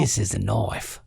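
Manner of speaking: understated Aussie impression; Paul Hogan Australian. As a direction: drop low into the Aussie punchline. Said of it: "Little Paul Hogan for you".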